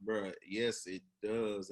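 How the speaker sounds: ordinary talk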